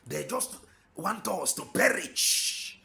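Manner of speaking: usual speaking voice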